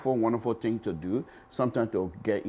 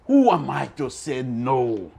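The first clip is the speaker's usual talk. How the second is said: ordinary talk, nothing marked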